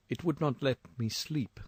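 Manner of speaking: ordinary talk, nothing marked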